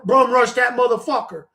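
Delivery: usual speaking voice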